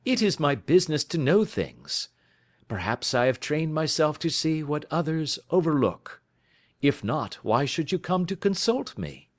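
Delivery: a lightly played sleuth holding forth; lightly voiced detective. Detective lecturing his friend on crime, lightly voiced and assured. Holmes answers with a laugh.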